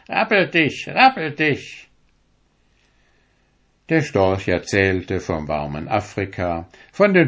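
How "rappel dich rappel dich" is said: high, quacky duck voices; high ducks nattering; jostling ducks. A narrator's reading. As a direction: push the words out like ducks shoving. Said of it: "Die Enten gingen rückwärts".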